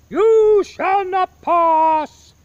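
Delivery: huge, slow, high bellow; Gandalf's shout. Retold or imitated. To the viewers, Gandalf bellowing you shall not pass. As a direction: roar it out like a wizard barring the way.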